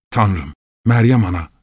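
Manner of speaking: a touch of panicked pleading to heaven; murmured frightened prayer. A narrator's reading. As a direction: pray fearfully under duress. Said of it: "diye mırıldanıp duruyordu".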